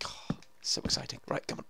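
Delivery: hushed, breath-only thrill; excited whisper. Real, not acted. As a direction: whisper it in suspense. Creeping up on mobs, excited.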